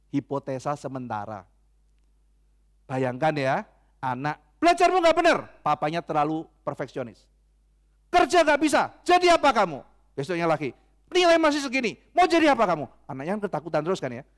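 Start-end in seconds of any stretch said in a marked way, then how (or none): 4.6-5.4 loud, high-pitched parental scolding; angry scolding father. Acted. Perfectionist papa scolding his child over studies, angry and loud. 8.1-9.8 high, loud berating; angry scolding father. Acted. Perfectionist papa berating his child, angry shouting. 11.1-12.9 loud, high dressing-down; angry scolding father. Acted. Papa attacking his child over poor grades, angry shouting.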